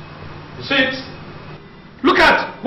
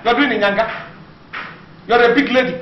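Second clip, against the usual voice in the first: normal talking voice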